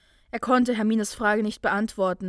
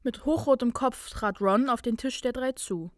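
ordinary delivery

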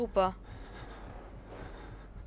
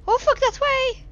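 high, loud, frightened squeal; panicked squeak; 14 semitones higher, 9 dB louder, slower than usual. Real, not acted. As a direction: shriek it out in a tiny voice. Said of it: "j'ai une voix de souris".

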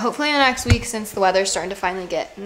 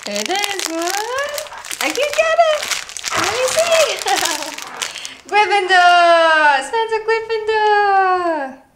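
gasp, then a much higher, louder, slow cry of the house; excited announcing; 11 semitones higher, 8 dB louder, slower than usual. Real, not acted. Sorting her dogs into houses, thrilled high announcing. Sorting-hat game with dog.

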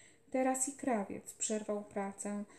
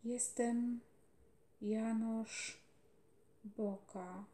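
fading from soft to barely there, slow words; dying, tired voice; 5 dB quieter, much slower than usual. A narrator's reading. Dying boy repeats his friend's name, fading to nothing. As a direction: let each word die away. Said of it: "zmęczonym, zamierającym głosem".

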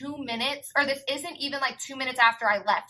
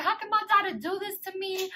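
high-pitched mock motherly lament; imitated upset mom; 7 semitones higher, 3 dB quieter than usual. Mother phoning about her daughter's betrayal, high mock lament. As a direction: wail it lightly, like a wounded mother.

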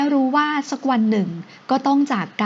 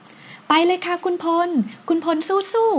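cheering shout; 7 semitones higher, much slower than usual. Acted. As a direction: cheer him on from his back. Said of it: "พร้อมตะโกนร้องเชียร์เสียงดังลั่น".

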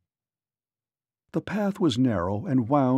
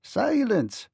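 high, small-voiced command without extra volume; little man's high-pitched hush order; small ferryman; 8 semitones higher than usual. A narrator's reading. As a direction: order silence as a tiny man. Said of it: "said the small ferryman".

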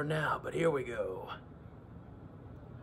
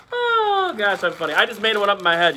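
ordinary talk

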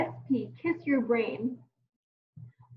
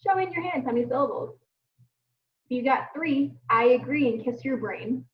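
ordinary delivery

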